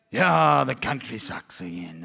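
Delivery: low grumbling moaner, trailing down; mock complainer. Imitating negative complainers.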